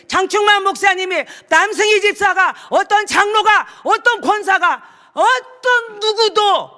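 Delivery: loud, high, ringing sermon delivery; fervent preaching. Acted. Pastor proclaiming to his church, in full-force preaching. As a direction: preach it out to the whole congregation.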